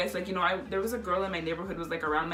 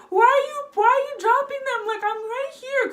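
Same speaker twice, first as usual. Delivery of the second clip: very high, loud whine; hammed-up squealing complaint; retold exasperated protest; 5 dB louder than usual. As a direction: protest like a wronged kid. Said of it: "they would get so mad".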